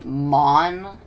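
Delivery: mon drawn out slowly and loudly; mon, slow and emphatic, like "mahn"; pronunciation demo. Shown as a demonstration. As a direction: stretch mon with an open "ah". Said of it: "kinda like man".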